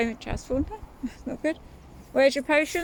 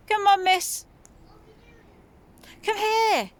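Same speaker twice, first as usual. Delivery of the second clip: calling out; 10 semitones higher than usual. Real, not acted. Mum calls her daughter to come over, raised voice. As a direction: call her over firmly.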